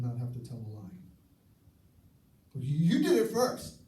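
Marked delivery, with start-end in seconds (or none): 2.5-3.8 higher, louder defensive whine; whiny accusation. Acted. Spouse in an argument, higher, louder defensive whine. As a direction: blame the other one like a sulky kid. Acted childish argument quote.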